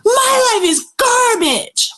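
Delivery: high, drawn-out venting yell; yelling at the sky. Retold or imitated. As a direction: yell it slowly across a lake, pitch way up. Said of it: "yell at the sky and the lake".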